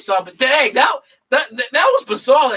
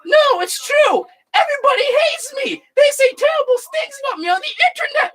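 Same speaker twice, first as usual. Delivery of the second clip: Jar Jar voice. Retold or imitated. Jar Jar whining to Anakin, high mock sobbing voice. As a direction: whine it like a wounded goofy creature. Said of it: "He's like".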